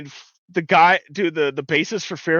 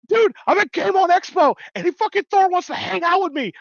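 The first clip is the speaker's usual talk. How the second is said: high, squealing fanboy gush; hammed-up starstruck excitement; retold excitement; 14 semitones higher than usual. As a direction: breathlessly share huge news, pitch way up. Retold excited call about meeting Thor.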